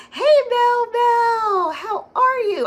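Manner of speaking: high, loud, stretched-out hello; drawn-out, sing-song welcome to a friend; beaming welcome for a viewer she missed; excited greeting. Real, not acted. Greeting a viewer joining the live.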